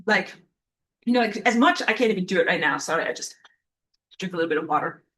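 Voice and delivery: ordinary talk, nothing marked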